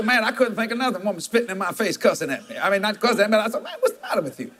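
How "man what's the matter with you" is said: much higher, laughing mock exasperation; incredulous laughing retort; laughing exasperated question. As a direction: squeak it out in disbelief. Retold exasperated reply.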